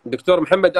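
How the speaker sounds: loud apologetic call to the doctor. Real, not acted. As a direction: call his name across the line, loud. Host calls guest after dropout.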